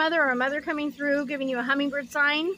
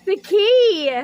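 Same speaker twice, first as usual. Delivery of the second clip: excited; 6 semitones higher, 9 dB louder than usual. Real, not acted. Excited card discovery.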